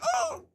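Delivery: high, soft "oh" of pain; squeaked fake groan; mock pained groan. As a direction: imitate a man hit below the belt. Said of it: "man, this freaking balls".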